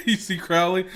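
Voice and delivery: high, loud burst of laughing; real laughter. Real, not acted. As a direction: crack up laughing through the words. Cracking up while telling a story.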